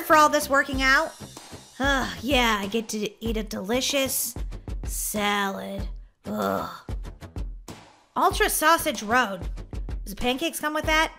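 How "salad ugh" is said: low, soft, deflated groan; glum disgust. Acted. Gamer's reward is a salad, glum disgust. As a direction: drop it low with dreary reluctance.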